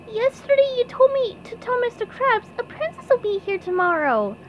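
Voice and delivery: very high cartoon voice; SpongeBob voice. A narrator's reading. SpongeBob explaining to the driver, high-voiced. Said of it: "explained SpongeBob".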